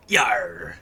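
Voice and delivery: gruff pirate growl. Pretend. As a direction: throw out a pirate yar. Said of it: "so hard not to talk in a pirate voice".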